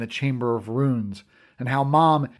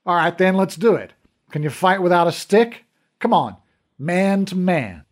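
fighting challenge; 5 semitones higher, 5 dB louder than usual. Acted. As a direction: goad him into the fight. Squaring up for a fight.

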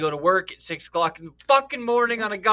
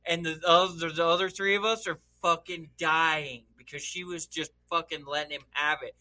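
usual speaking voice

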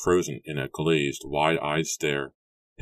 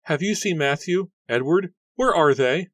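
high, loud, panicked calling; shrill with fear, searching for the brothers; a frightened sister crying out for her brothers; 13 semitones higher, 6 dB louder than usual. A narrator's reading. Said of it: "Rebecca cried".

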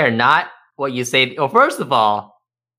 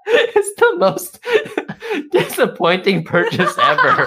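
high squeals of laughter through the words; laughing. Real, not acted. Friend about a bad robot ball purchase, laughing. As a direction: laugh helplessly while saying it. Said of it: "laughing through words".

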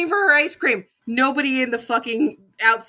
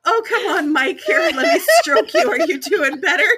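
higher-pitched, giggly coaxing; still laughing; 6 semitones higher than usual. Real, not acted. Co-host talking to her flopped mic, still laughing. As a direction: coax it along through leftover laughter. Still cracking up, teasing microphone.

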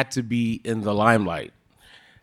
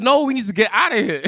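urgent; 11 semitones higher, faster than usual. Retold or imitated. Friend urging escape from shootout.

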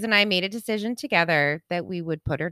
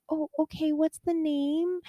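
gently ask, imitating a sweet young receptionist; 8 semitones higher, 5 dB quieter than usual. Young vet receptionist asking a grieving owner, sweet and high. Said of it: "She was so sweet".

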